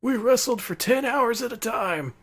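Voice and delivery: put-on slow, high old-timer reminiscing; shaky old voice. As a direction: grumble like a creaky old wrestler. Old wrestler voiced after "he's so old.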